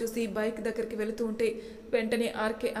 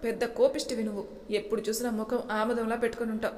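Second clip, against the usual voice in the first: usual speaking voice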